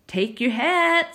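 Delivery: a hint of grandma fussing; grandma sending him to the fields; grandma's instruction. A narrator's reading.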